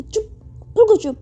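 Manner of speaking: put-on fed-up carer silencing a whiny girl; stern hush. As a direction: shut her up firmly. Priyasha silences Maya.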